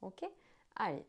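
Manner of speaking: ordinary talk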